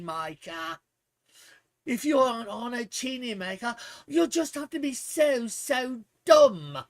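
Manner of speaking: mocking, snooty British accent; playful British accent mocking a know-it-all. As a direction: sneer it in a put-on British accent. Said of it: "for using a British accent".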